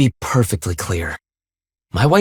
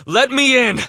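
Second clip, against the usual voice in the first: much higher, straining cry; forceful demand; 10 semitones higher than usual. Acted. Forcing his way into tower.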